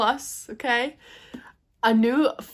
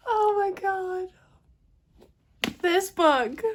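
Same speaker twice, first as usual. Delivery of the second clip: awed; 9 semitones higher, slower than usual. Real, not acted. Overwhelmed after finishing.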